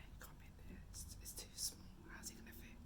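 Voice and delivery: doubtful whisper; whispering back in the dark hallway. Retold or imitated. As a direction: hiss it low, disbelieving. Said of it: "we was being really quiet".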